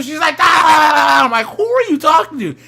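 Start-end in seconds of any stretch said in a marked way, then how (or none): 0.4-1.2 high, loud rattling yell; hammed-up rapid-fire yelling; mock phone yelling. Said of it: "she's on the phone". 1.5-2.5 high, loud incredulous question; hammed-up startled demand; mock astonishment. Acting his startled reaction to yelling.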